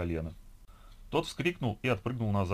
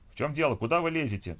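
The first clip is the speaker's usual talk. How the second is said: higher-pitched indignant challenge; cross, raised voice; angry voices; 6 semitones higher, 4 dB louder than usual. A narrator's reading. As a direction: protest like someone in a queue being pushed past. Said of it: "Тут же последовали гневные голоса".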